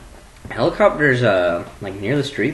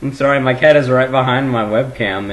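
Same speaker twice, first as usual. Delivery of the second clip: usual speaking voice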